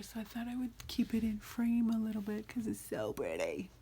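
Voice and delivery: a soft whisper to herself; whispered aside. Real, not acted. Vlogger whispering to viewers while flipping pages, hushed. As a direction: breathe it out under the camera.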